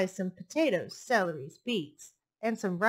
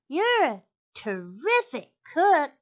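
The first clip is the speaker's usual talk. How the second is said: high, loud, slow piglet praise; flattering piglet; 6 semitones higher, 7 dB louder than usual. A narrator's reading. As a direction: gush over the fox's cooking as the piglet. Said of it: "said the piglet".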